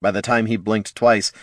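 plain reading voice

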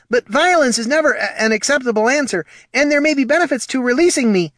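high, loud, rushed pleading; panicked pleading. Acted. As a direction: babble to talk your way free.

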